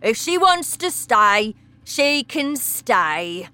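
father giving in; indifferent father. Acted. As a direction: wave it through. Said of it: "said Mister Wormwood".

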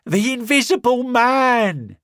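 high, loud panicked yell; terrified shout. Acted. Marvel shouting warning to the town, high panicked yell. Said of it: "he shouted".

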